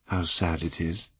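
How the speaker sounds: softly murmured, almost to himself; hushed wistful murmur; sad murmur. A narrator's reading. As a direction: murmur it sadly at the portrait. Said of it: "murmured Dorian Gray".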